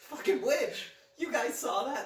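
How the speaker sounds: high, loud, laughing fake fury; laughing mock outrage. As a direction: shriek it through laughter as an outraged fan.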